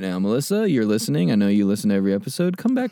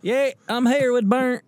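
put-on Southern accent; 12 semitones higher than usual. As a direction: play a goofy Southern guy. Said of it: "It sounds like a Southern guy saying Bert".

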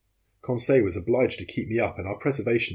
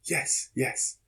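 whisper; 5 semitones higher, 4 dB quieter than usual. A narrator's reading. Exhausted swimmers answering in murmurs.

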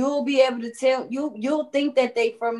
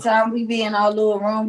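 ordinary talk, nothing marked

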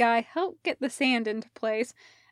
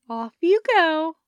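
breezy shooing; 7 semitones higher, 7 dB louder than usual. Acted. Parker shoos the boys.

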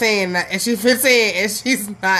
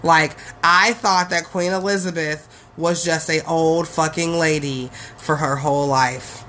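usual speaking voice

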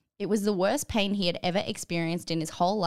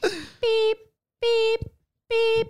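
high loud beep beep beep; high electronic beeping; alarm beeps; 6 dB louder, much slower than usual. Retold or imitated. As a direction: imitate a watch alarm. Imitated alarm watch.

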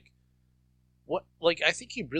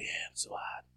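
whispered, self-satisfied mock quote; smug whisper; 10 dB quieter than usual. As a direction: whisper it like you fancy yourself. Imagined smug Seagal quote.

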